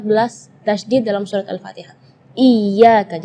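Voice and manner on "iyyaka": iyyaka with a loud, held double y; pressed tasydid. Shown as a demonstration. Teacher shows where the pressure goes, ya leaned on. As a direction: punch and hold the "yy" in "Iyyaka". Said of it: "jadi tekanan".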